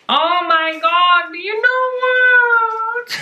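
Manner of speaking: high, drawn-out, slow squeal; thrilled squeal. Real, not acted. To Olga, overjoyed at the gift, high slow squeal. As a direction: let it soar up, stretched and slow. Recognizing a childhood plant.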